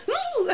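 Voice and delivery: a high, sudden fangirl squeal; excited squeal. Real, not acted. Fan squealing as her idol walks out. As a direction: squeal at seeing him up close. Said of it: "And I, like, squealed".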